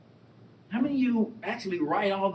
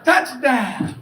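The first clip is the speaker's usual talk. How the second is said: laughing cheer; 8 semitones higher, 5 dB louder than usual. Retold or imitated. Band plays as he runs.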